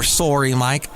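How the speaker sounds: sorry said as "SORE-ee", Canadian style; a Canadian "Sorry", with a rounded O; Canadian accent. Retold or imitated. Quoting condolences he dislikes, in a Canadian accent. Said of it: "if you're Canadian".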